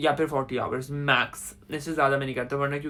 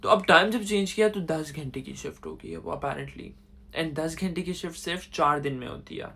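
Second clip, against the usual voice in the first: usual speaking voice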